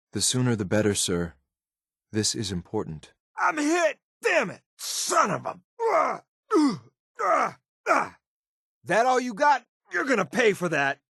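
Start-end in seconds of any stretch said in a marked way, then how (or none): 3.4-4.6 high, sharp yelp of a soldier just shot; wounded soldier yelling in pain; pained cry. Acted. 4.8-8.2 high strained gasps of pain; hurt soldier groaning; pained groans. Acted. Wounded in combat. 8.8-11.0 defiant through pain. Acted. Wounded fighter taunting.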